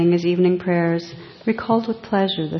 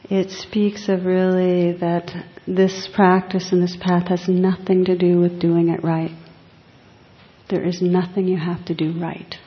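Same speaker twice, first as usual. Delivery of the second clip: normal talking voice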